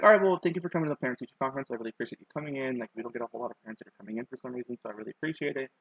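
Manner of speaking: fast, softening teacher sign-off; rattled-off thanks. Retold or imitated. Teacher thanking mom for coming to the conference, rattled off. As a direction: rattle through the polite formula quickly.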